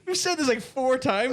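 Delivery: real high exasperated shout. Real, not acted. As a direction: shout at the chat. Exasperated at chat.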